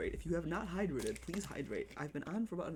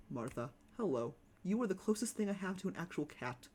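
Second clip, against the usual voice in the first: ordinary talk, nothing marked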